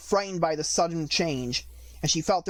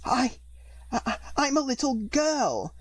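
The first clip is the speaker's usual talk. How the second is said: doubtful; 9 semitones higher, slower than usual. A narrator's reading. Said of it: "rather doubtfully".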